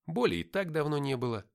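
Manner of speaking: plain reading voice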